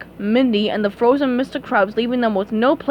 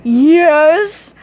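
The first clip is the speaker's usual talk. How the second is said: louder gleeful villain yes; louder cackling villain yes; Plankton cry; 4 semitones higher, 9 dB louder than usual. Acted. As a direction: crow it like a mad villain. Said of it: "cried Plankton".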